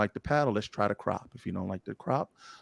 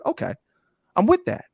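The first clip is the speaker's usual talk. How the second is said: high agreeing quote; 11 semitones higher, 4 dB louder, faster than usual. Retold or imitated. Agreeing to her friend, answering in a high eager voice. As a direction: voice jumps up high and louder on the okay. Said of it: "And I was like".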